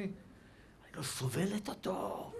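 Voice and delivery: soft, drawn-out loathing; wife's mock disgust. Wife to rabbi about her husband, hushed mock loathing. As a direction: stretch it out slowly, barely voiced.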